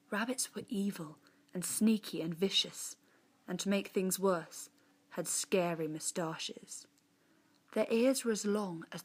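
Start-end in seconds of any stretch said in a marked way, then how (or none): none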